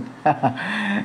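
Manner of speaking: brief chuckle to oneself; a short, light laugh between sentences. Real, not acted. Teacher asks students how many mistakes they see, short chuckle.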